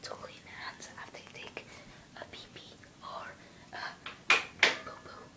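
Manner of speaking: hushed whisper so parents won't hear. Real, not acted. To viewers while parents are downstairs, whispering. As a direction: keep it breathy and secret, barely voiced. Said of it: "I have to be quiet 'cause my mom and dad are downstairs".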